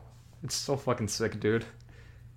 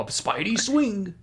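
much higher, slow, hammed-up Spidey swing; slow high cartoon callout of Spidey swing; game voice line impression; 9 semitones higher, much slower than usual. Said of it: "Just like that".

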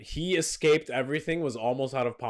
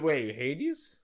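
ordinary delivery

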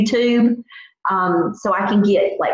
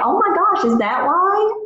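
surprised; 8 semitones higher than usual. Retold or imitated. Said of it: "And I'm like".

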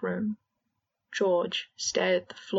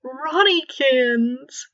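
teasing; 6 semitones higher, 8 dB louder, much slower than usual. Acted. Boggart Fred teasing Ron.

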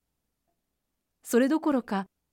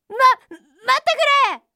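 high, loud stammered plea to stop; panicked cry; 12 semitones higher, 7 dB louder than usual. A narrator's reading. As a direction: call desperately after someone escaping. Rabbit calls after fleeing deer. (慌てて叫ぶ、高く大きな声)

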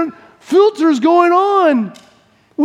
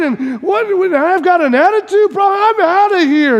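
indignant outburst; 6 semitones higher than usual. Acted. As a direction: blow up at the boss. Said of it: "And the guy goes".

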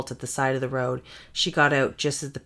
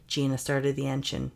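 usual speaking voice

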